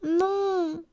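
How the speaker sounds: high, drawn-out refusal; high storybook galette voice; little galette. A narrator's reading. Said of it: "dit la galette".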